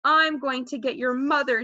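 ordinary delivery

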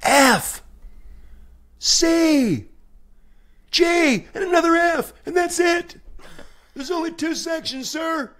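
very high falsetto yell; comic high-pitched screamed instructions; mock scream. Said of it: "I just scream".

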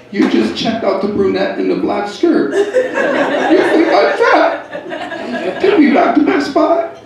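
high, slow, hurt nag; moody GPS voice. Retold or imitated. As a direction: whine it like a jealous girlfriend. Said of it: "G P S decided to go P M S".

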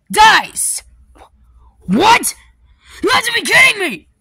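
high, loud outraged yell; outraged shout. Acted. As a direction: yell in disbelief. Outraged reaction.

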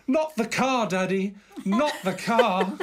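high, drawn-out mock plea to Dad; hammed-up pleading child. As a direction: plead like a toddler putting on an act. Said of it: "He was acting".

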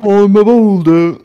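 deadpan rock impression; talking rock. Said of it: "my impression of a rock".

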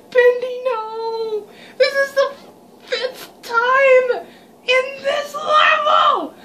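high, loud despairing cry, last words drawn out; anguished cry. Real, not acted. Player caught again by Bendy, wailing in exasperated despair. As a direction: wail at dying yet again.

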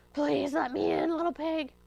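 put-on pleading fox voice; begging. A narrator's reading. Fox at Hamlet's door on a cold night, begging. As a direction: beg to be let in. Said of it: "he begged".

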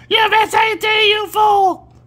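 high, loud mock yell; imitating an angry viewer. Imitating an angry viewer scolding him, high mock yell. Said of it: "screaming and yelling at me".

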